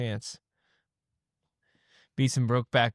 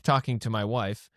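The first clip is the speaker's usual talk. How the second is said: ordinary delivery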